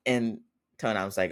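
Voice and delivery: normal talking voice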